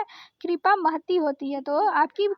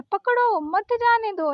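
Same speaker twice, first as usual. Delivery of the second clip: पकड़ो raised and held, then मत जाने दो; slow, higher, break right after पकड़ो; comma pause after पकड़ो; 4 semitones higher than usual. Shown as a demonstration. Teacher shows students how it is spoken, pausing after पकड़ो. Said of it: "ऐसे बोलता है ना".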